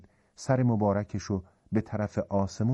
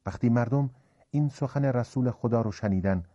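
usual speaking voice

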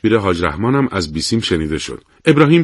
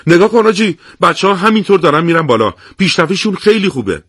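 high, louder delighted call; joyful; 8 semitones higher, 3 dB louder than usual. Acted. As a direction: point it out in joy. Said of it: "با خوشحالی گفت". (با خوشحالی و صدای زیر)

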